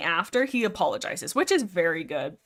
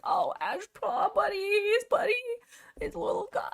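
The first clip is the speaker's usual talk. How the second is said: pitying coo; 12 semitones higher, 5 dB quieter than usual. Retold or imitated. The reader pitying sad Ashpaw, high and teary. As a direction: coo over poor little Ashpaw. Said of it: "I'm like".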